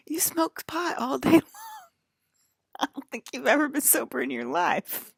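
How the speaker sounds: high, loud, laughing scoff; mocking laugh-through. Teasing a pothead about his sobriety, laughing high and loud. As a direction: laugh it off in their face.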